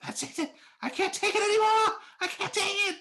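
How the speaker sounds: high-pitched, loud frantic scream. Acted. Character upstairs who has had enough, screaming. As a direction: scream it at the end of your rope. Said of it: "you hear Cthulhu clearly screaming".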